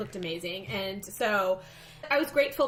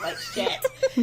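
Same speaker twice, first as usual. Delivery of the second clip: high, slow words squeezed out through laughter; giggling helplessly at herself; laughing. Real, not acted. Nervous patient laughing about her awkwardness, laughing through words.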